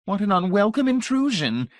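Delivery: put-on high, petulant old lady. A narrator's reading. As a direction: sniff at the rude intrusion. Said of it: "brows drawing pettishly together".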